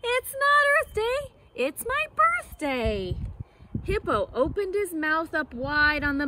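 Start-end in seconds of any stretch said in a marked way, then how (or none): 0.0-3.2 high, proud monkey voice with NOT jumping up; proud silly monkey. Acted. Silly monkey brags to the jungle, high and proud. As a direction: crow it like a cheeky monkey. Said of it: "proudly said".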